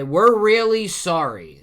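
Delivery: high, drawn-out mock-wailing apology; loud apology. All the giant pirates apologise to Loki, loudly.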